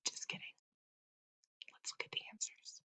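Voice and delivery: soft whispered take-back of the joke; joking whisper. Acted. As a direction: whisper it, letting them off the hook. Teasing aside before answers.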